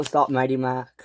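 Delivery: plain reading voice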